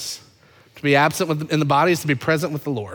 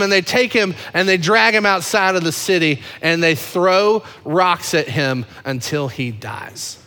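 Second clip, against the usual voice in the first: ordinary talk, nothing marked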